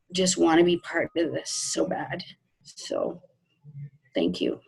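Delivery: softly choked up, voice dropping away; heartfelt thanks, barely getting it out through tears; tearful. Real, not acted. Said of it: "Now you made me cry".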